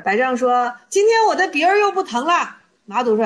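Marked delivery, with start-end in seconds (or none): 0.9-2.6 high, emphatic comeback; acted bold retort. Disciple tells his master about his nose, boldly. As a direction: answer back with raised pitch.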